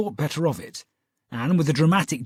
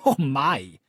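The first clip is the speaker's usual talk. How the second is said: exclaiming. Acted. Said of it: "she exclaimed".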